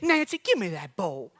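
lightly voiced old lady taking over; bossy sweet neighbor. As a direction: grab the bowl with a hint of an old lady's voice. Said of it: "had an accent".

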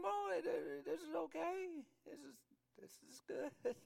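high, strained put-on voice; mock revellers. Mocking drunken churchgoers approving of themselves, silly high voice. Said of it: "And they, they're going".

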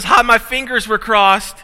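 put-on high, loud, slow little-girl triumph; gloating daughter. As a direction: crow like a cheeky kid who won.

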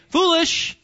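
high-pitched, scoffing verdict; mock dismissal. Preacher voicing most people in the world, mock dismissive.

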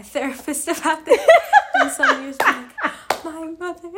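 high, loud and breaking into laughter; joking, cracking up mid-sentence; laughing. Real, not acted. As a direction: laugh through the words. Joking about future therapy complaints.

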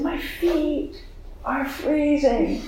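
high, soft, slow tearful wail; weeping. Retold or imitated. Weeping to her friend in a hug, high tearful wail. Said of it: "I just started weeping more".

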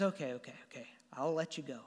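low, soft, resigned concession; reluctant giving in. Retold or imitated. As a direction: give in quietly to a persistent youngster. Saul finally gives in to David.

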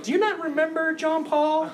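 playfully incredulous, up high; mock disbelief. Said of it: "I'm like".

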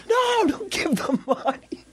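very high, squeaky scolding imitation; mock exasperated uncle. Uncle scolding in retold exchange.

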